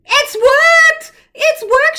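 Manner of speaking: high, loud delighted cry; excited cry. A narrator's reading. Grandma Poss crying out as Hush appears. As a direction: cry out in joy. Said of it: "she cried".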